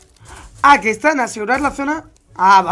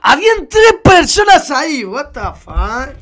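astonished shout; 2 semitones higher, 7 dB louder than usual. Real, not acted. Streamer spotting three people there, astonished shout. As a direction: cry out in sudden surprise.

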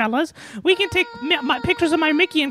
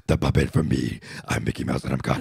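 extremely low, softer demon Mickey; 6 dB quieter than usual. Pretend. Puppet introduces himself as God, in demon voice. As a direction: drop right down into a demonic register. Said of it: "My demon voice".

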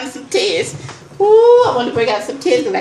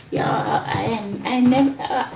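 ordinary talk

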